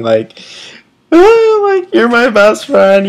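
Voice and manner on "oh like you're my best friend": high, loud, teary wail of goodbye; tearful bawling. Retold or imitated. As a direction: retell the sobbing farewell in a raised, crying voice. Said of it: "both bawling".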